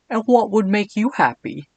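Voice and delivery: earnest, flustered question; Edwin voice. Acted. Edwin nervously asking the Cat King, high and prim. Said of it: "my best Edwin voice".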